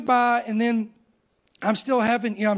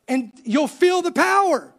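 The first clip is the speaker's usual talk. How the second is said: higher, emphatic pulpit delivery; fired-up preaching; 6 semitones higher, 3 dB louder than usual. Real, not acted. Preacher urging the church to respond to true power, fired-up exhortation. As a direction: drive it home to the congregation, stretching the words.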